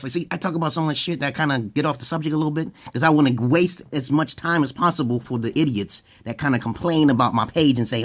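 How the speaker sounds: ordinary talk, nothing marked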